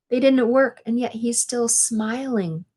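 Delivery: ordinary delivery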